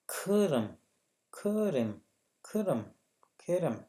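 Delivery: खरम called out loud and high, over and over; loud, repeated खरम drill; repeated drill word. Shown as a demonstration. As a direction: drill खरम loudly for the class. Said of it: "जैसे खू".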